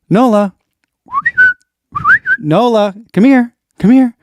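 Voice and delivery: high, loud call to a puppy. Real, not acted. Owner calling her puppy Nola over, high and loud. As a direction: sing out her name so she comes running.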